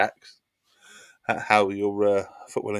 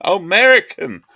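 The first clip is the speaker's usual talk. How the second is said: high, loud oh American; real surprise at his own misreading; sudden realisation; 10 semitones higher, 11 dB louder than usual. Real, not acted. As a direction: blurt it as the penny drops.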